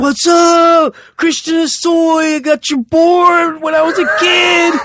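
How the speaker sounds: high, loud, drawn-out holler; retold inmate shout. Inmates greeting a newcomer walking into prison, imitated loud high shout.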